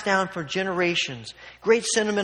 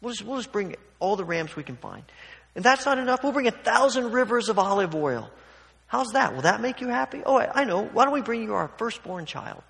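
high-pitched, mounting mock offers; escalating sarcasm; 6 semitones higher than usual. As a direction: pile on the sarcasm as the offers grow absurd. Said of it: "the sarcasm raises another level".

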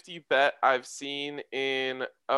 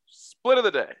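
segment announcer; 8 semitones higher, 6 dB louder than usual. Acted.

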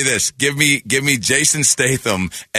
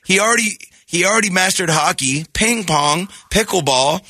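put-on high, begging young boy; pleading kid voice; 8 semitones higher than usual. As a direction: beg dad like a desperate kid. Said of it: "I'm gonna be the kid".

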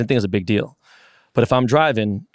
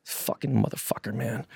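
muttered curse; 11 dB quieter than usual. Retold or imitated. Pesci sore after the made putt.

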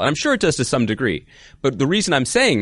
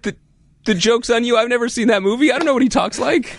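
excited; 12 semitones higher than usual. Real, not acted. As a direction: fire back, laughing and outraged. Indignant excited outburst.

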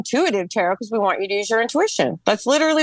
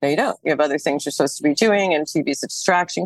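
a lower, firm inner voice telling her no; inner voice of intuition; 8 semitones lower, faster than usual. Retold or imitated. Her intuition tells her not to fix the TV, low and firm. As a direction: speak as her own calm intuition, lower. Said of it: "my intuition kicked in".

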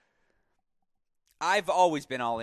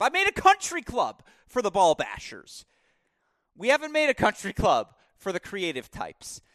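mock indignation; 8 semitones higher, 10 dB louder than usual. Real, not acted. Mock-outraged complaint about country clubs.

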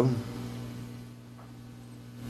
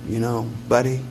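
fading softer and lower toward the end; a touch of childlike softness; 8 semitones lower, 5 dB quieter than usual. Retold or imitated. Grandmother telling Buddy life is short, fading quiet. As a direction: let it sink to a soft hush. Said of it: "her face took on that of a child".

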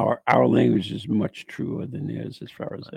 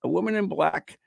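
higher-pitched read-aloud narration; ghost story read aloud; 6 semitones higher than usual. Acted. Host reading of a haunted farm girl, narrating. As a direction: read it out as a pub legend.

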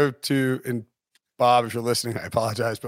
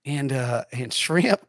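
hesitant, put-on Southern accent; phony Southern twang; fake Southern accent; 2 semitones higher than usual. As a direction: fake a folksy Southern accent, stumbling for words. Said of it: "just faking his Southern accent".

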